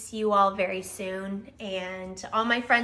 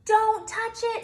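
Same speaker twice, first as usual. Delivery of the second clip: urgent warning; 12 semitones higher, 7 dB louder than usual. Acted. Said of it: "said Bear".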